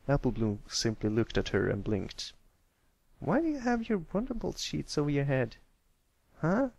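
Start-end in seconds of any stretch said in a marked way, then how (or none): 3.2-6.7 high, puzzled filly voice. Acted. Apple Bloom puzzled by the sheet, high. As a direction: ask like a confused kid.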